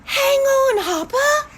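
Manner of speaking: group shout. Shown as a demonstration. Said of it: "Let's say it together".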